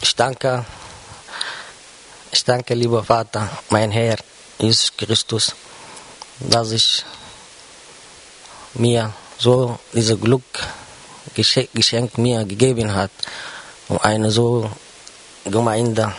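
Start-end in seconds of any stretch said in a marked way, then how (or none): none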